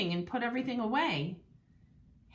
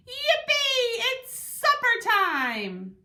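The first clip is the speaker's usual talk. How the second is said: high, loud squeal of joy, stretched out slow; overjoyed yelp; 11 semitones higher, 6 dB louder, much slower than usual. A narrator's reading. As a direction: squeal like a thrilled puppy. Dog overjoyed at supper.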